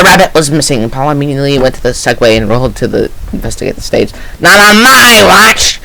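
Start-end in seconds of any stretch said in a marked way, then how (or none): 4.4-5.8 high, slow heroic line; heroic declaration. Acted. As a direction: announce it like an action hero. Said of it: "Paul said to Bonnie".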